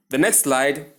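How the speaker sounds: ordinary talk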